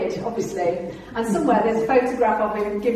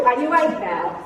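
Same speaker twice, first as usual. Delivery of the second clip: laughing tease. Retold or imitated. Teasing scared visitor.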